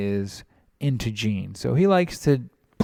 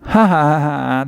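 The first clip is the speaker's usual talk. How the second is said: mock laugh; 5 semitones higher, 7 dB louder than usual. Jean laughs off Colby.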